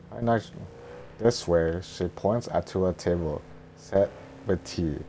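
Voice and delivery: ordinary delivery, nothing marked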